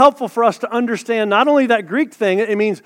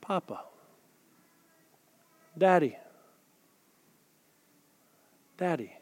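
tender, soft; 5 semitones lower, 14 dB quieter than usual. Real, not acted. Preacher showing the church the tender sense of Daddy. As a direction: softly savour each name, much quieter.

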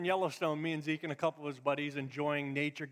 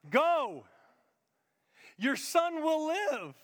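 loud, slow proclamation; ringing pulpit proclamation; proclaiming; 10 semitones higher, 7 dB louder, much slower than usual. Acted. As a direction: declare it with slow force. Preacher voicing Jesus, loud.

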